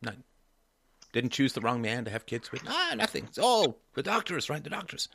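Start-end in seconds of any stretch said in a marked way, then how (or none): 2.7-5.1 mock dismissive. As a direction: wave it all away in a high mimicking voice. Mocking mother's blame on doctors.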